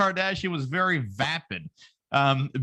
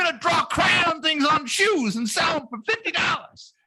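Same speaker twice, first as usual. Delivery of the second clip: mock [name]; 11 semitones higher, 3 dB louder than usual. Mocking [name]'s voice.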